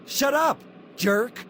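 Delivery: high, sharp rude retort; snapping shut up. Acted. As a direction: spit the insult upward.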